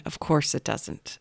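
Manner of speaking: whispered under the breath, defeated; exasperated whisper. Retold or imitated. Mother stuck at a locked door, thinking to herself, whispered. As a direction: breathe it out to yourself, voiceless. Code fails, exasperated inner thought.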